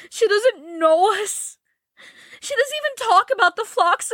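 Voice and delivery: put-on high, slow, crying girl's voice; Joe, tearful and desperate. A narrator's reading. Joe telling Laurie Beth is dying, weeping. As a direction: let the voice break with tears.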